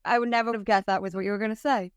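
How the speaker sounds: fast, laughing through the words; giggling in real surprise. Real, not acted. Host laughing at guest's answer.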